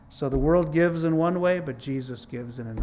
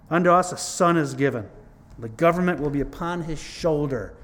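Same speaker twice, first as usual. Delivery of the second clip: louder, proclaiming scripture reading; 2 semitones higher, 6 dB louder than usual. Acted. Preacher reads Isaiah to the church, louder proclaiming. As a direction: declaim the verse to the congregation.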